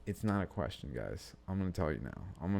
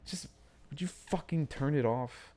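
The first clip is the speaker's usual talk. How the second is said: a little aggressive; 8 semitones higher than usual. Real, not acted. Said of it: "that was a little aggressive".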